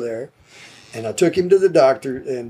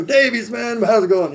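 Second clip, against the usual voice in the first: lightly imitated, higher-pitched pal's call; a hint of a friendly holler, pitched up; chummy greeting; 8 semitones higher than usual. Musician hanging out in his office, chummy greeting.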